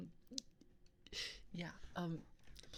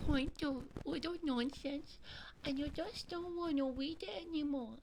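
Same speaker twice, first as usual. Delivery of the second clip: reading the post in a raised, high voice; high-pitched voice; 7 semitones higher than usual. Shown as a demonstration. Reading a post aloud for friends, in a high-pitched voice. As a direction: voice acting a higher-pitched reading. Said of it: "All right, I'm doing this".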